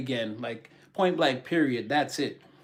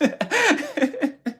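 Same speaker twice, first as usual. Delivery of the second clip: high-pitched burst of laughter; high giggle. Real, not acted. Laughing after push-ups joke.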